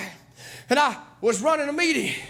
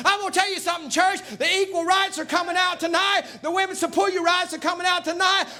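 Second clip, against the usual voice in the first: preaching at full force; 4 semitones higher than usual. Acted. Preacher rousing the church about real preachers, full-force preaching. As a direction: thunder it out to the church like a revival preacher.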